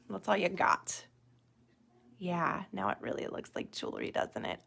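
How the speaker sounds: normal talking voice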